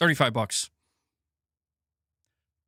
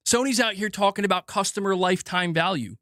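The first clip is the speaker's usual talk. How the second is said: usual speaking voice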